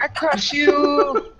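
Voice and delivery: slow booming barbarian voice. Acted. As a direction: growl it out like a brute. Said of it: "No, it's like".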